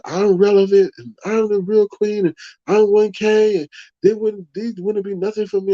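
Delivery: high, mocking imitation of a boastful rival; lightly sending up her bragging; mock quote. Streamer mimicking a rival who calls herself queen, high and mocking. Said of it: "what she said".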